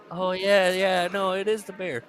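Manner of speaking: high-pitched placating voice; hammed-up appeasing reply; mock agreeing. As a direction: play along in a high voice. Imitating reply to frantic questioning.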